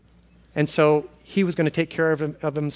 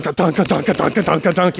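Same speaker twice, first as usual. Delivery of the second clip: pounding heartbeat. Retold or imitated. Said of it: "And my heart is going".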